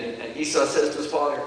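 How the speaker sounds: ordinary talk, nothing marked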